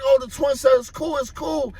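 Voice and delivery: high-pitched pleading protest; imitated crying excuse. Teenager excusing himself to a gunman, imitated crying plea. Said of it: "And I was crying".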